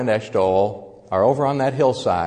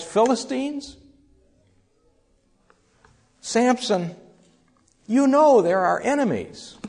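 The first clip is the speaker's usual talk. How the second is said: pleading parents' voice pitched much higher, hurt and exasperated; heartbroken, angry parents; 8 semitones higher, 3 dB louder than usual. Acted. Samson's parents scold and plead with their son, heartbroken and angry. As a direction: plead high and hurt like a grieving father. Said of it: "the heartbreak ... and the anger probably as well".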